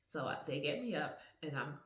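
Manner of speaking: ordinary talk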